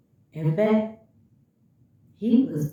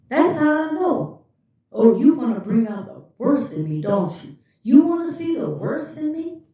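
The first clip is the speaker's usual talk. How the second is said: loud, high, accusing rant; mock accusation; 5 semitones higher, 5 dB louder than usual. Narcissist accusing her of cheating because her story changed. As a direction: accuse her like a jealous partner. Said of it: "he'd be like".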